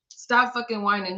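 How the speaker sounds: normal talking voice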